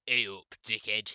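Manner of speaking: loud Northern hey-up call; brash loud hello; loud greeting. Acted. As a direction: bark it at the intruder. Surprise visitor barges into room.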